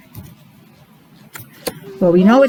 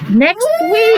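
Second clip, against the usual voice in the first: excited; 10 semitones higher than usual. Acted. Excited weather news.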